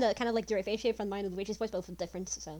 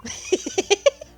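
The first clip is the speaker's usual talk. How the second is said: cackle; 9 semitones higher, 8 dB louder than usual. Acted. Character laughing in game.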